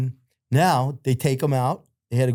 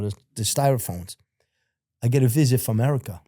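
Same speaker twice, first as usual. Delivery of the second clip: ordinary talk, nothing marked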